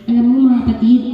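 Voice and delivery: ordinary talk, nothing marked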